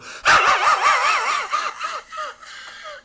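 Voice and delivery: very high, loud rattling laugh; put-on cartoon snicker, far above normal pitch; imitated cartoon laugh. Showing how Daz Butler got the job, high cartoon laugh. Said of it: "because he could go".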